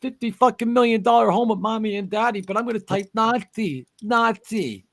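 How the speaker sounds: high, fast sneering parody; mock voice of a spoiled protester; mocking rich kid. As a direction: mimic a pampered rich kid. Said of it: "that just fires me up".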